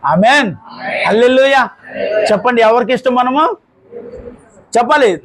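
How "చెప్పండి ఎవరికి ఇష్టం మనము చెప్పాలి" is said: ordinary talk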